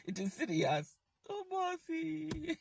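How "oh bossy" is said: higher, softer, slow sing-song tease; mock taunt. Mocking the boss, a higher, softer slow tease. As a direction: coo it like a sly taunt.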